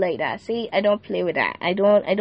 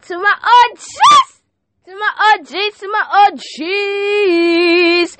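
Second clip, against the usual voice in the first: calling out; 8 semitones higher, 4 dB louder, slower than usual. Real, not acted. Calling out to his regulars.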